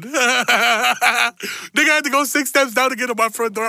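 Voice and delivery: high, slow, talking through laughter; laughing. Real, not acted. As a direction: squeeze words out between laughs.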